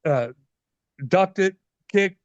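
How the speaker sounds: ordinary delivery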